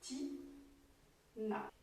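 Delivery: "Ti-na" softly split by a pause; soft "Ti", gap, then "na"; two separate syllables. Shown as a demonstration. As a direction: say "Ti-na" quietly as two beats. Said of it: "Donc, deux syllabes".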